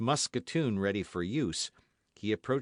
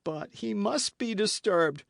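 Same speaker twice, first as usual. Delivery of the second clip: high, urgent protest; agitated host's cry; 12 semitones higher, 4 dB louder than usual. Acted. Host protests to D'Artagnan, agitated high cry. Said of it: "cried the host".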